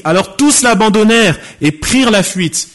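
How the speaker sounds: "tous" driven home, verse pitched high; emphatic quoting. Real, not acted. Preacher quoting scripture to prove all disciples fled, emphatic. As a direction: proclaim the verse high, leaning hard on "tous".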